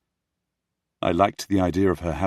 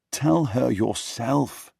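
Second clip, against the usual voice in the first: slow, higher, emphatic advice; firm urging; 6 semitones higher, 3 dB louder, much slower than usual. Acted. Simon gripping the prince's arm, firm urging. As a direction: stretch it out emphatically. Simon urges his friend.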